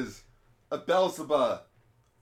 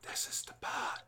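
slow, voiceless whisper; whispered movie quote; 8 dB quieter, slower than usual. Retold or imitated. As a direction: whisper it slowly as a mock threat. Quotes movie line as whispered threat.

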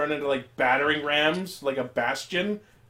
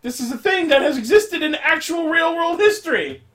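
high, loud, emphatic insistence; exasperated shout; 12 semitones higher, 7 dB louder than usual. Real, not acted. Podcaster arguing with co-hosts over conscription, exasperated shout. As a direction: shout it like you cannot believe you must explain.

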